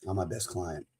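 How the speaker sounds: quiet repeat. Real, not acted. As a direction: let it drop to a near-private aside. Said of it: "repeated quieter".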